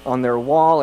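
ordinary talk

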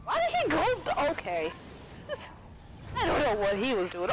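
normal talking voice

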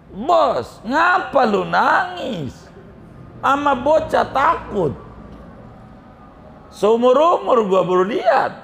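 high, stretched incredulous jeer; mocking astonishment. Henchmen to their crying boss, high mocking disbelief.

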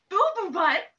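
gasping, loud shocked question. Acted. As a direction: gasp in mock horror.

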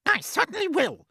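high, loud snap; snapping. A narrator's reading. Aunt Spiker agreeing to punish James, snapping. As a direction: snap it back shrilly. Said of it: "Aunt Spiker snapped".

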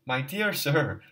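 high, loud indignant My dear sir; flushed and confused, bursting out in offense; angry irritable cry. A narrator's reading. Luzin, insulted, cries out angrily at Raskolnikov. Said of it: "cried out angrily and irritably".

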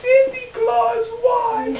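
little Cindy Lou asking the Grinch why; little girl voice. A narrator's reading. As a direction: ask like a puzzled tiny girl. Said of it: "She stared at the Grinch and said".

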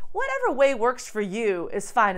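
ordinary delivery

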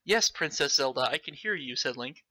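ordinary talk

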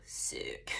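soft, drawn-out whisper; whispered delight. Retold or imitated. Patient learning she has new breasts, whispered delight. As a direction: breathe it out in a mock-awed whisper.

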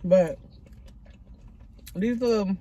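talking with mouth full; muffled between bites; chewing food. Real, not acted. Seen 2: Picks food from bowl, chewing with closed mouth, fingers at lips.

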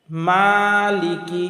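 slow "মালিকি" with a long held MA; stretched recitation. Shown as a demonstration. Teacher showing the correct word, slow stretched recitation. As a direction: draw out "মা" in "মালিকি", end on KI. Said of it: "মিমের উপরে এক আলিফ টান হবে".

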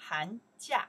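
Han jia called loud with a rising jia; model pronunciation. Shown as a demonstration. Teacher leading the class drill, loud and clear. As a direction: say it out clearly for repetition. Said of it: "one more time".

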